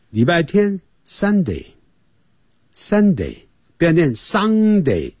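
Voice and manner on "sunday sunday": Sunday drawn out slowly; slow model reading. Shown as a demonstration. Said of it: "我们现在就跟着讲".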